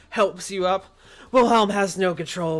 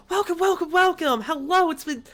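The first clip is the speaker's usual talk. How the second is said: put-on high, eager welcome from an old gnome; excited gnome host; 9 semitones higher than usual. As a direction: greet the guests like you've been waiting years. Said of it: "He goes".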